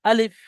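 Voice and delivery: আলিফ with the final ফ as a breathy f, not p; alif ending in f. Shown as a demonstration. Teacher models correct alif for students, breathy f ending. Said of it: "ফর মতো উচ্চারণ করবে".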